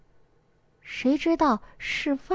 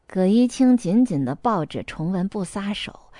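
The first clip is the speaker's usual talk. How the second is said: ordinary delivery, nothing marked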